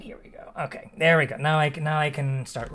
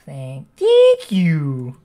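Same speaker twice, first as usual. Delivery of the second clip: slow, louder clicking bug voice; clicking beetle; 9 dB louder, much slower than usual. Pretend. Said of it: "The beetle impression".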